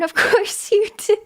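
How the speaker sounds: high, breaking into laughter; laughing disbelief. Real, not acted. Streamer reacting to a viewer taking a loan, laughing disbelief. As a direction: laugh helplessly through the words.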